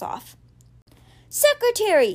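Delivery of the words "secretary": high-pitched, raised-voice call; yelled call. A narrator's reading. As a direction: call out sharply for the secretary, stopping short of a full yell. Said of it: "he yelled".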